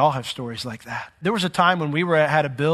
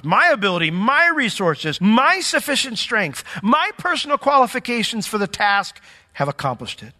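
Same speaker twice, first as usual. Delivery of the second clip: self-congratulation with MY pitched up high; mock boasting; 6 semitones higher, 3 dB louder than usual. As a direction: act out a puffed-up braggart. Voicing the self-congratulating heart.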